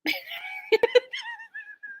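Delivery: high, shrieking burst of laughter; screaming laugh. Real, not acted. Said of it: "I'm screaming".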